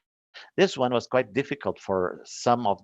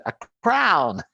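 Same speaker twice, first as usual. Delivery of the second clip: high, louder called-out answer; students' answer; 9 semitones higher, 5 dB louder than usual. Retold or imitated. Students answering the teacher about the picture, called out. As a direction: mimic the class shouting it.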